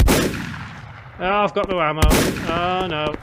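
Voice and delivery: out of ammo and panicking; panicking gamer. Real, not acted. Out of ammo mid-fight.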